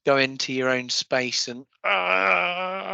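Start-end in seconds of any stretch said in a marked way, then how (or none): 1.8-2.9 high, drawn-out "ah"; cry of release. Shown as a demonstration. Going into your own space to let it out, cry of release.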